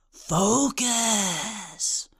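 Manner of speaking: eerie, drawn-out whisper; witch's whisper. A narrator's reading. Chaos Witch directs Oxwald's gaze, whispering. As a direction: whisper it like a spell. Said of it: "the Chaos Witch whispered".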